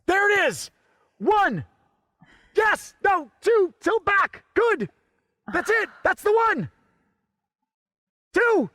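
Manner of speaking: high-pitched frantic yelling; urgent, high shouted commands; frantic urging. Acted. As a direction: push the reluctant assistant to act now. Urging assistant through the stunt.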